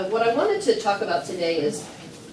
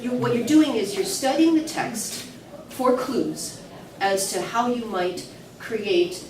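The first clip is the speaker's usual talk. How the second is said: usual speaking voice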